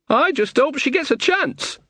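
high-pitched grumbling aside; muttered aside. A narrator's reading. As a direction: grumble it high, as a worried villager. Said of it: "muttered Tinker".

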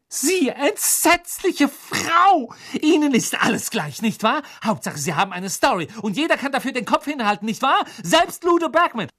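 very high, outraged tirade; high-pitched righteous fury; furious outburst. A narrator's reading. Hermine rises to attack the reporter.

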